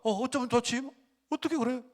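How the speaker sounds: high-pitched, mock-tearful fretting; 울먹이는 흉내. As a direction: imitate someone tearfully upset for a stranger. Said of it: "막 울어요". (높은 목소리로 울먹이며 안타까워하는 흉내)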